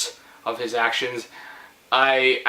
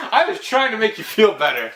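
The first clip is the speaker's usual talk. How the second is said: said through laughter, higher; laughing; 8 semitones higher than usual. Real, not acted. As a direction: keep laughing while explaining. Still laughing.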